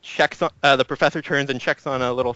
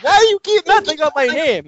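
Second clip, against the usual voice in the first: high, put-upon voice; indignant professor; 13 semitones higher, 4 dB louder than usual. Acted. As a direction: whine it like an offended old teacher. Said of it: "And he says".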